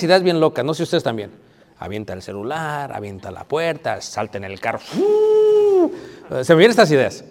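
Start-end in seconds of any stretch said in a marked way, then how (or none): 4.8-5.9 sharp high whoosh of rushing off. Retold or imitated. Ideas locas, saltar al carro. (silbido agudo de salir corriendo)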